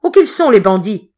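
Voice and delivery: loud, angry challenge; loud, gruff threat; growling demand. Acted. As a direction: growl it at the unseen bandits. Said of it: "gronda-t-il".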